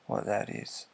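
usual speaking voice

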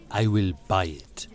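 low, controlled offer in character; calm, deadly serious. A narrator's reading. Gallery owner offering to buy, calm and serious. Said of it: "He was calm now, deadly serious".

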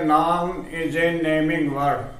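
very slow, word by word, for students to write down; slow dictation. Shown as a demonstration. Teacher dictating a definition to students, very slow and clear. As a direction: spell it out slowly so the class can copy.

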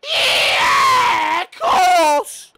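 very high, very loud drawn-out shout; exasperated yell. Real, not acted. Gamer reacting to the match, exasperated yell. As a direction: yell it at the top of your range. Yelling at the game.

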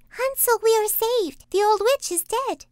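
put-on very high, loud girl's voice; joyful cry. A narrator's reading. Gretel frees Hansel from the stable, high excited cry. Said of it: "and cried".

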